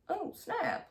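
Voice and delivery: high-pitched amazed little reaction; impressed. Retold or imitated. Watching roommate scare him off, impressed. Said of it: "I was really, like, impressed".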